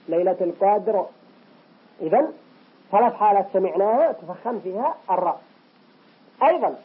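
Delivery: ordinary delivery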